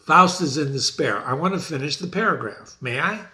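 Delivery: ordinary talk, nothing marked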